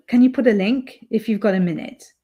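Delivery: ordinary talk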